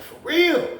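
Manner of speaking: high, loud disbelief; shocked for real. Retold or imitated. Told his roommate was with Rick, loud shocked question. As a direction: jump up in disbelief. Hearing the celly news.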